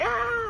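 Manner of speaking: very high happy yelp; relieved cry. Retold or imitated. Lost child spotting his rescuer, high squeal. Kid sees dad's friend.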